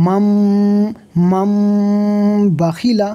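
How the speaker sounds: held, humming m at the end of মাম; nasal ghunnah. Shown as a demonstration. Teacher showing where the ghunnah falls, long nasal hum. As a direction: draw out the closing m of মাম as a nasal hum, then বাখিলা. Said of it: "তো এখানে গুণ হবে".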